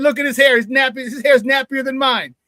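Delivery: high, loud re-enacted jab; heated comeback. Retold ball-busting comeback to teammates.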